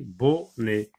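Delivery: "bonnet" split bon... net; brief gap between "bon" and "net"; two syllables. Shown as a demonstration. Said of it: "تتكون من مقطعين".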